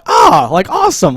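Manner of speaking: ah, then a high delighted awesome; imitating gleeful nerd. Imitating him enjoying the puzzle, gleeful awesome. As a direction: gush with delight, voice jumping up. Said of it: "I was imitating him".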